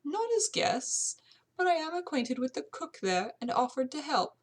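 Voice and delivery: high-voiced housekeeper, fondly explaining; proud servant recalling her young mistress; amateur British servant voice.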